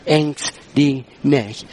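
solemn, word by word; emphatic scripture quote. Retold or imitated. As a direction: set each word apart, low and slow. Preacher quoting God's word emphatically.

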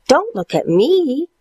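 chewing while talking; mouth full. Acted. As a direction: talk around a mouthful. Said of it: "chewed Stuart".

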